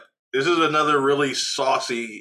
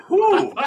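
sharp whew exhale; 5 dB louder than usual. Real, not acted. Exhaling after spicy wing.

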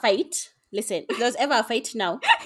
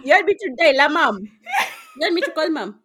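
teasing threat; 7 semitones higher, 7 dB louder than usual. Acted. Teasing threat to tell mom.

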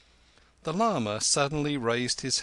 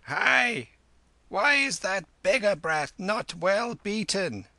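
high, loud, scolding call; old woman's cry; 10 semitones higher, 5 dB louder than usual. A narrator's reading. Old woman behind the curtains demanding a beating, scolding cry. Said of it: "the old woman cried".

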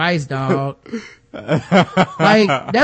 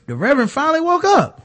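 mock amazement; 9 semitones higher than usual. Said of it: "I was like".

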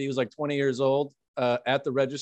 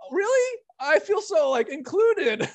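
much higher, laughing mock delight; 12 semitones higher, slower than usual. Friend mimicking John's joy at being insulted, very high. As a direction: gush as if honored, laughing. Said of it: "you were like".

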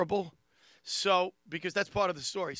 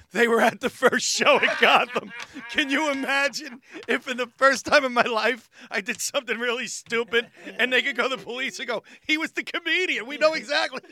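imagined witness voice; 6 semitones higher, 11 dB louder than usual. Retold or imitated. Imagined witnesses telling police.

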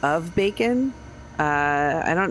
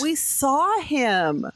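very slow, higher-pitched insistence; insistent protest; 7 semitones higher, much slower than usual. Acted. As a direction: stretch it out like a believer contradicted. Player insists Bigfoot was real.

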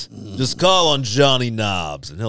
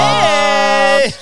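shout; 8 dB louder than usual. Real, not acted. Greeting on air.

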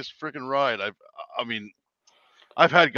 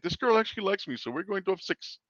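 higher put-on Indian accent; lightly voiced Indian passenger; 8 semitones higher than usual. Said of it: "I'm gonna do an Indian voice".

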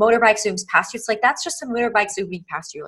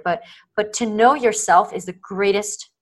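normal talking voice

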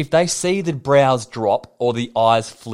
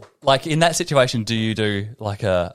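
ordinary delivery

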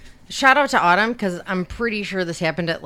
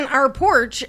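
louder, higher, insistent comeback; retold exasperated retort; 6 semitones higher, 4 dB louder than usual. Parent arguing by phone with kid about a photo, exasperated. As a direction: argue back as if it's obvious.

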